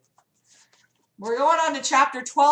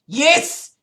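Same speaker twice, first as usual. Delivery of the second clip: higher-pitched pleading cry; 7 semitones higher than usual. Acted. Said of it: "he pleaded".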